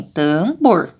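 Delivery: TAMBOR split, long TAN then BOR with oh; O in last syllable. Shown as a demonstration. Teacher confirming the right vowel, TAMBOR with O. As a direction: sound out TAMBOR slowly, O in BOR. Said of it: "agora sim".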